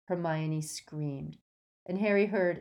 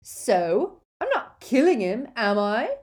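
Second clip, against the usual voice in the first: loud, defiant thug's retort; yelling; 6 semitones higher, 9 dB louder than usual. A narrator's reading. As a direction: yell back, shrugging off restraint. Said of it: "yelled Crabbe".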